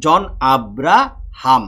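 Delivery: very slow, syllable by syllable; teacher sounding out a name syllable by syllable; slow syllable demo. Shown as a demonstration. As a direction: drag out each syllable of জন Abraham. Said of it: "হাম হচ্ছে একটা বদ্ধাক্ষর".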